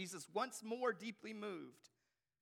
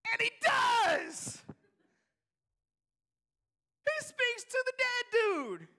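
high, drawn-out, astonished shouting; full-force preaching shout; 12 semitones higher, 13 dB louder, slower than usual. Real, not acted. Preacher proclaims Jesus speaking to the dead dude, shouted. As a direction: cry it out slowly at the top of your range. Preacher climaxes the Lazarus miracle.

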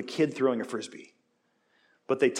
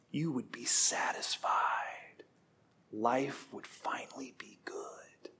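slow, seductive whisper of temptation; tempting whisper; 8 dB quieter, slower than usual. Acted. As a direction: breathe the promise softly, luring them in. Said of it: "it's saying".

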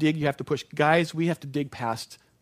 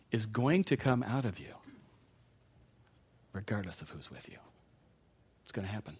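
hushed, weighty repetition; softly repeated point; 4 semitones lower, 6 dB quieter than usual. Real, not acted. Preacher lowering his voice for the congregation to ponder. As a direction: voice dropping low and soft to let it sink in.

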